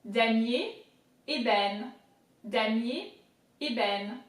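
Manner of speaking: "Damier, ébène" in careful French; French vocabulary drill, each word set apart; French pronunciation. Shown as a demonstration. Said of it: "let's go all together".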